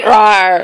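silly little "rawr" growl; playful bear roar. Pretend. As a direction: roar like a bear, half laughing. Playful bear growl after bear talk.

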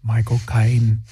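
low, drawn-out British accent on "Michael Caine". Shown as a demonstration. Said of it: "saying Michael Caine in his accent".

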